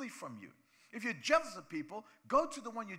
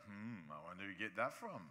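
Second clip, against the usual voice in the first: low, suspicious mutter of a jealous onlooker; mock suspicious grumble, low and soft; jealous churchgoer; 10 semitones lower, 6 dB quieter than usual. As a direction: sneer it like a jealous neighbour. Preacher mimics a jealous churchgoer.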